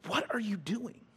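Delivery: acted indignant question, not shouted; righteous indignation. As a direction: call out wrongdoing in appalled disbelief. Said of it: "This is righteous indignance".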